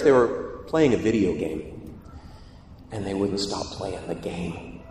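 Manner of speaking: usual speaking voice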